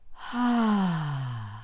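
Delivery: soft, controlled open sigh on ah; gentle, focused release of breath. Shown as a demonstration. Voice teacher demonstrating the right sigh, soft focused sigh. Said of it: "Nice and focused sigh goes like this".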